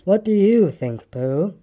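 slow, gloomy donkey voice; slow grumbled question; grumbling. A narrator's reading. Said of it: "grumbled Eeyore".

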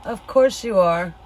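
usual speaking voice

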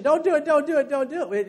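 loud, high pleading no; mock pleading flesh. Flesh begging the believer, loud high pleading. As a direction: cry out don't do it as the flesh.

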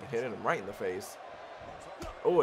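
usual speaking voice

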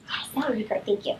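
whispering to a helper; quick hushed thanks offstage; whispered aside. Real, not acted.

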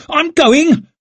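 loud, very high squawk; squawked protest. A narrator's reading. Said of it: "squawked Leo".

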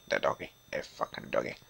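low, fast whispered groan; despairing whisper. Real, not acted. Gamer despairing over the dog, fast low whisper.

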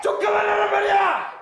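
high, tearful yell; crying shout. Shown as a demonstration. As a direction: scream it out in despair. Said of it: "더 크게".

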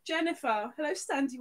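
normal talking voice

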